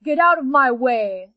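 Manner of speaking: loud angry panther command; angry loud demand. Acted. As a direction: shove the words at them. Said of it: "said the panther".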